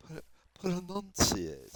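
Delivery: slow, over-careful "Put, put enunciate", every syllable spelled out; careful enunciation. Shown as a demonstration. Dropping the Jersey accent, he says "enunciate" slowly and carefully. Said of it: "try to pronounce correctly".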